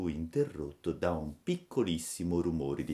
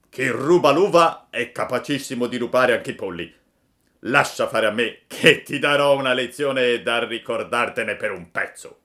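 loud accusing farmer voice; angry farmer; 7 semitones higher, 11 dB louder than usual. A narrator's reading. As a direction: confront the chicken thief.

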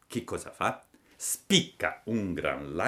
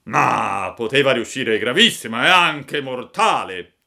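put-on loud, raised old-man voice; little old man; 5 semitones higher, 10 dB louder than usual. A narrator's reading. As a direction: reassure loudly as an elderly villager. (vecchietto a voce forte)

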